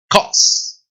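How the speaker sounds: "cause" as kos with a firm k; "cause" set apart, k hit hard; English hard C. Shown as a demonstration. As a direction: model "cause" in English, long final s. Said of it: "causa en inglés se dice".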